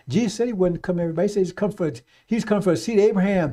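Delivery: ordinary delivery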